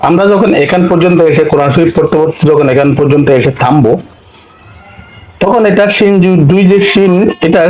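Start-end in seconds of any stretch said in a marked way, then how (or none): none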